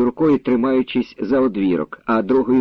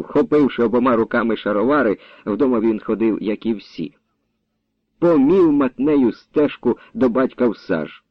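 plain reading voice

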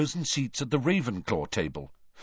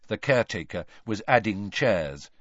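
plain reading voice

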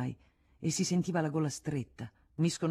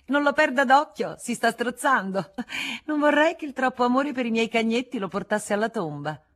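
high, loud, urgent exclamation; mock alarm; 8 semitones higher, 8 dB louder than usual. A narrator's reading. Marescialla pointing out the gorging scribbler, mock alarm. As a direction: sound the alarm with teasing exaggeration. Mock warning about the choking writer.

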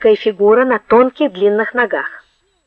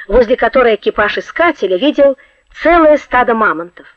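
plain reading voice

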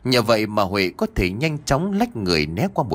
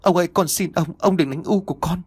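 put-on high, frightened girl's plea; pleading in a raised, tearful pitch; desperate pleading; 7 semitones higher than usual. A narrator's reading. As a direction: beg desperately for your mother. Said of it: "Cô lắc đầu xua tay nói".

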